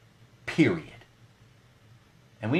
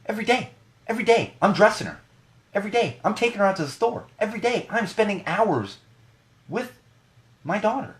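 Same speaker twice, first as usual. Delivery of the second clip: heated insistence; 10 semitones higher than usual. Real, not acted. Ranting that he dresses her daily.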